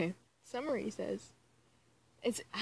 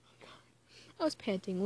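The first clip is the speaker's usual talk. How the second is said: ordinary talk, nothing marked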